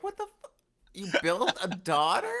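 shocked disbelief. Retold or imitated. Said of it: "I'd be like".

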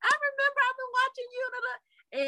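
high, bubbly fan voice; gushing fan. Retold or imitated. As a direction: gush like an admiring fan. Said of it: "someone like you, like".

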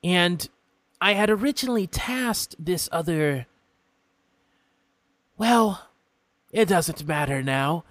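a polite, curious imp stranger; imp folk voice. Acted. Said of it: "he continues".

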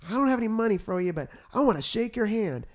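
put-on light Southern country accent, quick and high; lightly imitating a country accent. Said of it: "He kind of talked country accent".

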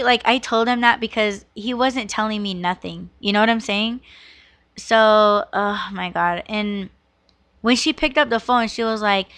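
ordinary delivery

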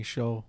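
"show" with a SH sound, low and soft; mimicked Uruguayan pronunciation. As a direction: quietly mimic the Rioplatense SH in "show". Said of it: "y'all be saying".

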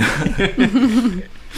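high-pitched laughing burst. Real, not acted. Laughs at teacher reply.

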